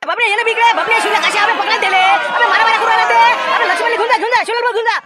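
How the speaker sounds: high, loud shouting over the brawl; chaotic yelling. Acted. Villagers shouting while pulling the fighters apart, frantic yelling. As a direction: yell to break up the fight.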